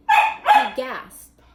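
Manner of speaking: sudden high gasping exclamation; gasped. A narrator's reading. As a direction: catch your breath in shock, pitch leaping up. Narrator gasps line in surprise.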